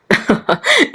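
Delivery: high-pitched giggle; laughter. Acted. Listeners laugh at the girl's complaint, high giggle.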